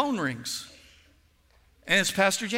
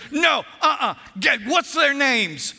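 high, loud protective outburst; hammed-up protective outrage; retold indignant shout; 9 semitones higher, 7 dB louder, slower than usual. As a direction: cut in shouting, ready to fight. Pastor indignant on friend's behalf.

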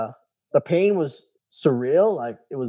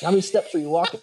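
a laughing mock of a prim nurse, pitched higher; retelling the nurse's question to a friend, mocking and amused; 6 semitones higher, faster than usual. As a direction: parody her incredulous question with a grin. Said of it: "And she asked".

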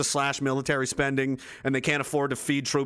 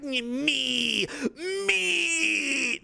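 put-on high, whiny cry of a greedy kid; mock selfish whine; 11 semitones higher than usual. Mocking selfish grandkids, high whining cry. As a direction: whine it like a spoiled brat.

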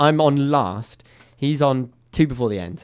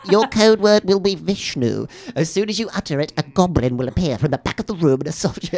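mock game master; 4 semitones higher than usual. Escape room host giving the code word, mock game master. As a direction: announce the rules with playful portent. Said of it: "the code word".

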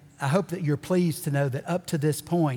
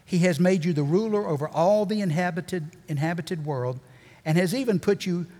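usual speaking voice

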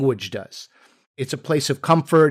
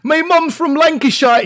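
high, drawn-out mock Lancashire accent; fake British accent; 12 semitones higher, 4 dB louder than usual. Bluffing a nosy questioner about home, in a fake British accent. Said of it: "you have a British accent".

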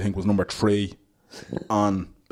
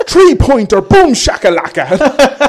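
high, loud hype call, slow on boom shakalaka; commentator shout; 14 semitones higher, 13 dB louder, slower than usual. Retold or imitated. Cork commentator hyping a three-pointer, loud and high. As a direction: roar it like a fired-up Cork commentator. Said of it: "in his Cork accent shouting".

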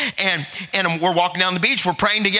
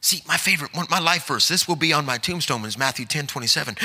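usual speaking voice

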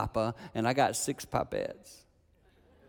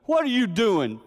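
loud, high challenge; grandpa's stern shout; 10 semitones higher, 9 dB louder than usual. Retold or imitated. Grandfather catching a man in the yard, loud stern shout. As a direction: bark the question like a stern grandfather. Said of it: "he goes".

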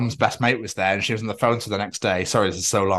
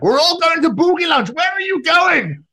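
shouting across streets; 6 dB louder than usual. Retold or imitated. Man calling after people in the street, shouting. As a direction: bellow after passers-by in a high voice. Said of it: "shouting at people across the streets".

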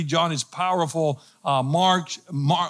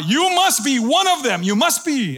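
high, emphatic pointing of blame; insistent accusation; 10 semitones higher, 4 dB louder than usual. Retold or imitated. Bystander accusing Peter of being a follower, insistent. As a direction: imitate an accuser pressing hard.

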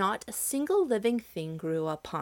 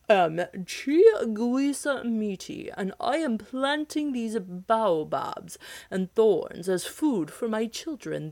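slow, louder giant voice; lightly voiced giant; 2 semitones higher, 4 dB louder, slower than usual. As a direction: speak with the slow pride of a giant. Giant introduces himself.

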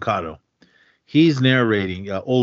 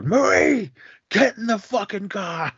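much higher, hammed-up shouted order; mock mobster yell; 12 semitones higher than usual. Young Italian guy ordering his girlfriend into the car, hammed-up yell. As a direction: bark at Marie like a hotheaded wiseguy. Acted movie quarrel, mobster voice.

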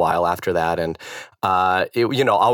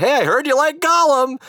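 high-pitched, overeager party opener; retold eager approach; 4 dB louder than usual. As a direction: pitch it up high, breaking the ice. Retold tipsy icebreaker to stranger.

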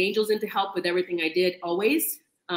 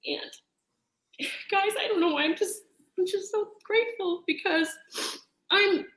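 crocodile tears; 7 semitones higher than usual. Real, not acted. Guest to her audience, crying happy tears of gratitude. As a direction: speak through tears of joy. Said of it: "I am really crocodile tears today".

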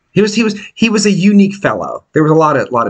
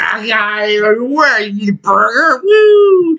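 put-on drunk roadie; 4 semitones higher, much slower than usual. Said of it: "He's like".

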